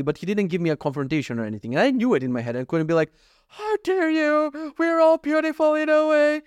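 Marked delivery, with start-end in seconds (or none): 3.5-4.7 very high, drawn-out put-on voice; mock outrage. 4.8-6.4 very high, sustained put-on voice; mock offended idealist. Mock offended reply, continued.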